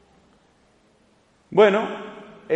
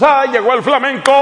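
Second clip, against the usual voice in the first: mocking shout; 7 semitones higher, 6 dB louder than usual. Mocking the neocatechumenals.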